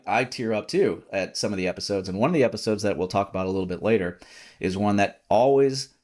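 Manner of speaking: ordinary delivery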